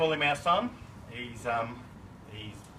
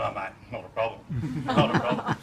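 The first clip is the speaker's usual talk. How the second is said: laughing; 6 dB louder than usual. Retold or imitated. Retold reassurance to Wixie, laughing.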